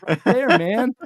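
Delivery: high, loud, slow whiny mockery; mocking voice. Teasing viewers about the stream finale in a high, loud mock voice. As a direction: stretch it out like a sarcastic heckler. Same mock quote ends.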